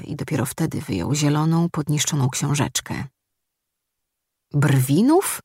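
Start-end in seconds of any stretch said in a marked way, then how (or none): 4.5-5.4 głośniej, z niedowierzaniem; żołnierz nie wierzy, skąd przyjechali; zdumione pytanie. Acted. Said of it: "spytał zdumiony". (zdumione pytanie)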